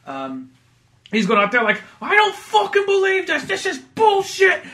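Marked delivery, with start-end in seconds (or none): 2.0-4.6 high, loud, fed-up rant; hammed-up New York outrage; mock angry New Yorker. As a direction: blow up like a furious transit cop. Acting out angry Fat Kaz.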